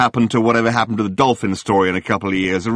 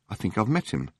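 weary Arthur; 9 dB quieter than usual. Acted. Arthur brushing off the journalist, much softer. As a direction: mumble it off, wanting no part of it.

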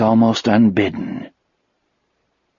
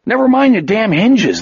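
agitated cop; 10 semitones higher than usual. A narrator's reading. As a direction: snap at the partner.

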